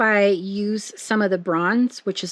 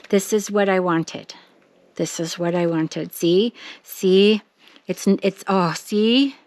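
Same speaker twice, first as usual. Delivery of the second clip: normal talking voice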